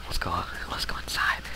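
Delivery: whispered creeper voice; playful stealthy whisper. Pretend. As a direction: sneak along in a hushed whisper. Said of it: "talking in the creeper voice".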